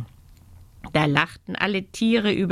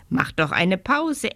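ordinary delivery, nothing marked

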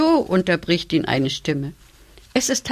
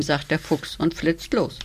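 plain reading voice

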